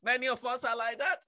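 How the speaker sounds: low, soft aside; subdued, low-key admission; quiet remark. Real, not acted. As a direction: say it softly, dropping the voice. Same words said quietly, then shouted.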